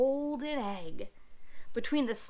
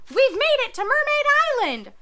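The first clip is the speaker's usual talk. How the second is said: very high, loud cheer; 8 semitones higher, 7 dB louder than usual. Acted. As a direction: shout for joy. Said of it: "cheered the parrot".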